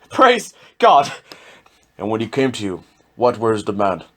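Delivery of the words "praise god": much higher, loud burst of joy; exultant cry. Acted. Judge hearing he saw the devil, exultant cry. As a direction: cheer the admission.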